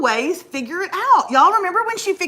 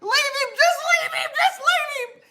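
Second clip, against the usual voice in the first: very high, wailing tearful plea; mock hysterical crying mixed with laughter; hammed-up sobbing; 12 semitones higher than usual. Passenger begging the driver to abandon her friend, sobbing. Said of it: "I'm sobbing".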